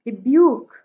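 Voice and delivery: Rebuke with a light रि and loud Buk; iambic stress. Shown as a demonstration. Teacher gives iambic example, Buk stressed in rebuke. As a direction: punch the second syllable of rebuke. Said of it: "Buk पे stress है".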